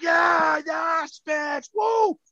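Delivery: high-pitched retold whoops; imitated cheering. Announcer answering a drunk fan, high imitated cheer. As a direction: echo the fan with a high holler.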